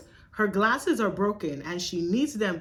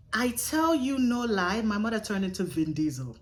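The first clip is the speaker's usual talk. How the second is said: usual speaking voice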